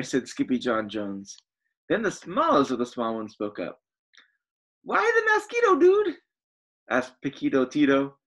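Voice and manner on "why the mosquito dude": tiny chihuahua voice. Acted.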